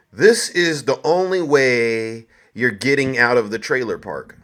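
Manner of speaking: ordinary talk, nothing marked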